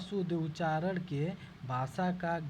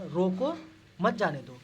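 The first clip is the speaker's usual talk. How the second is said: louder scolding रोको with pause before मत जाने दो; scolding command; 5 dB louder than usual. Shown as a demonstration. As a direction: bark रोको like a strict teacher, then pause. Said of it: "यहाँ पे आप लोग डांट के बोलिए". (डांट के बोलना)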